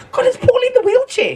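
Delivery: sudden amazed realisation; surprised. Retold or imitated. Surprise at seeing Paul.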